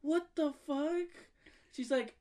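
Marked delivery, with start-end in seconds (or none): 0.0-1.1 high, slow, baffled reaction; acted disbelief. Reacting to the kid staring at his tummy, acted disbelief. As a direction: draw it out high in mock shock.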